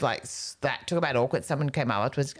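usual speaking voice

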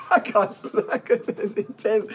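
real laughter taking over the words; breaking into laughter. Real, not acted. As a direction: try to speak while cracking up. Breaking into laughter mid-sentence.

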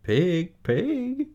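high, gentle coaxing call; lilting high-pitched farmer call; kind voice. Acted. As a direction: coax the pig over kindly. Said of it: "in a kind voice".

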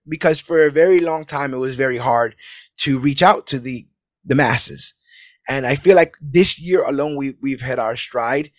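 ordinary talk, nothing marked

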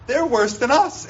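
high-pitched, rising protest; acted-out prophet's high protest; indignant protest. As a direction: object in disbelief, voice jumping up. Habakkuk protests to God in dialogue.

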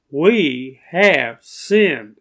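"we" drawn out very slowly and firmly; slow, weighty confession landing on "we"; each word spaced out, leaning on "we"; stress on WE. Shown as a demonstration. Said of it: "he used we".

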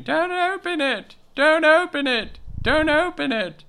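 hammed-up high shriek held back in volume; mock scream. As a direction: imitate a screaming boy, high and repetitive. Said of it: "Bob starts screaming".

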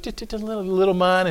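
ordinary talk

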